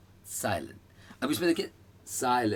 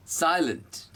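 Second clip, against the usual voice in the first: "Silent" with "साय" raised, "lent" dropped; stress on sai; 6 semitones higher, slower than usual. Shown as a demonstration. Teacher demonstrates the syllable stress of "silent". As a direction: lift "साय", let "lent" fall. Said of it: "साय उठेगा, lent बैठेगा।".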